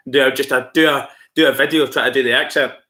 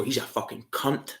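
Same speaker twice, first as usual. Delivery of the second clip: low, quiet contempt; hostile insult; 3 semitones lower, 11 dB quieter than usual. Shown as a demonstration. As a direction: say it lower and softer, meaning it as an insult. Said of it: "Unless you go".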